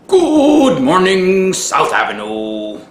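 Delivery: booming goofy host voice; silly announcer. Acted. Mister Fa Fa Fa intro.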